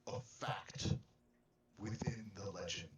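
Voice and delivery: put-on grave, measured old believer; solemn in-character voice. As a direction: speak as a devout elder. In-character speech in the game.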